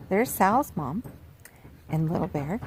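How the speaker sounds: ordinary delivery, nothing marked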